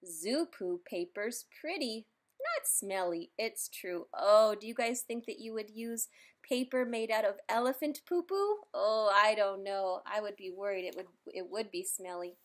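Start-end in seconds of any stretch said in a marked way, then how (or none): none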